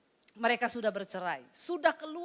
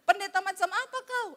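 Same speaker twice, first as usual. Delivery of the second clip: angry husband; 8 semitones higher, 4 dB louder than usual. Retold or imitated. Angry husband calls the pastor.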